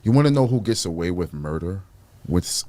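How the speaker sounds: ordinary delivery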